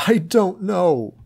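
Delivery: high, slow tearful wail; slow sobbing repeat. A narrator's reading. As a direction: drag it out through tears. George crying, repeating.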